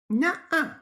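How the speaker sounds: very high little gremlin voice; squeaky child gremlin. A narrator's reading. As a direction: refuse in a high-pitched small child's voice. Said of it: "the little gremlin said".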